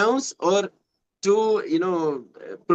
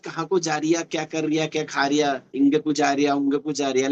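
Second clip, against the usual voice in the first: lower, level run of Chandpur village Hindi; Chandpur Hindi dialect; 4 semitones lower, much faster than usual. Shown as a demonstration. Learner showing a partner how hometown Hindi sounds, Chandpur dialect. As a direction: rattle off samples of western UP Hindi dialect. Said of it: "how we speak Hindi".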